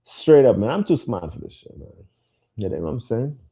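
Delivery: ordinary talk, nothing marked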